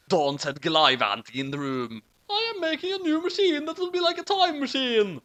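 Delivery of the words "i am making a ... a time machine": put-on much higher girl voice; squeaky little sister; high-pitched girl. A narrator's reading. Sister character speaks.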